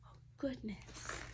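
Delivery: slow breathless whisper. Acted. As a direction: whisper it slowly, shaken with relief. Relief after rescuing Norman.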